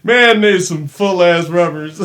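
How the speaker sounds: high and loud, breaking into laughter; loud laughing exclamation. Real, not acted. Reacting to his friend's mayonnaise joke, a loud laughing outburst. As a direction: shout it while cracking up.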